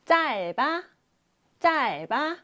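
짧아 read clearly as jjal-ba; pronunciation demo. Shown as a demonstration. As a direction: sound out 짧아 for the class. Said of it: "짧아라고 소리가 나요".

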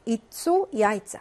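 이쪼 야이짜 said slowly, each syllable clear; slow careful pronunciation. Shown as a demonstration. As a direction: model 이쪼 then 야이짜 slowly for students. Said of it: "야이짜 이렇게 발음이 나죠".

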